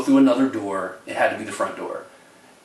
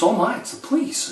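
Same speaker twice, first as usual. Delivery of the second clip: high alarmed old lady, imitated; startled gasp; 13 semitones higher than usual. Imitating the aunt startled by the police call. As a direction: squeak it out in shock.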